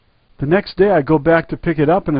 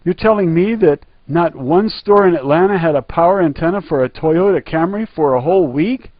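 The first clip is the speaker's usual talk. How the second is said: rising, louder, incredulous retold rant; outraged yelling; 6 semitones higher, 4 dB louder than usual. Customer yelling at the service clerk, raised incredulous voice. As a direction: tear into him, half shouting at the absurdity.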